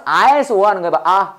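usual speaking voice

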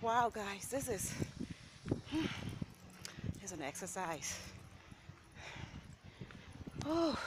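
panting after climbing a hill; breathless from the climb; out of breath. Real, not acted. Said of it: "I gotta catch my breath".

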